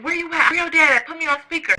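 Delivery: high, urgent demands; imitated riled mom. Mom calling daughter on the road, urgent. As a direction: pitch up into a fired-up mother.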